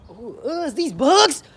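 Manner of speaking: high, loud squeal of disgust; bug freak-out. Real, not acted. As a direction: build from grossed-out noise to yell. Freaking out at bugs.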